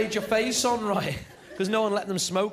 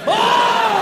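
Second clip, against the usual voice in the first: mock crowd "Oh!", much higher and louder; loud high whoop; 11 dB louder than usual. Crowd reacting to a crushing heckle, loud whoop. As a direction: whoop like a stunned audience.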